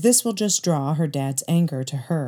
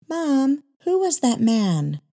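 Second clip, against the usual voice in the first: put-on much higher child's voice, curious; little girl's voice; 9 semitones higher, slower than usual. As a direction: ask like a curious eight-year-old. Young girl asks mother.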